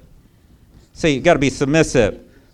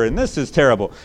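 normal talking voice

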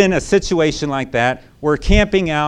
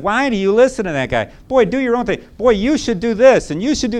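higher sassy gossip voice; nosy coworker egging her on, higher; mocking coworkers; 9 semitones higher, 4 dB louder than usual. Ladies at work telling the wife to ignore her husband, sassy. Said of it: "the ladies at work said".

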